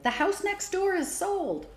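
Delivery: high and slow, thrilled; excited news. A narrator's reading. Girl bursting into dining room shouts excited news. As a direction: announce it like the best news ever.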